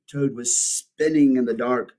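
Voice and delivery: ordinary delivery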